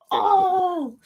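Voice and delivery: long, very high "oh" in imitation; re-enacted outcry. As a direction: replay the fans' stunned reaction. Retold reaction to botched play.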